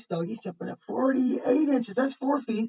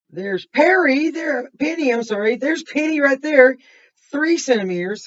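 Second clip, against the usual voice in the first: excited spotting; 7 semitones higher, 12 dB louder than usual. Real, not acted. Spotting Penny in the picture.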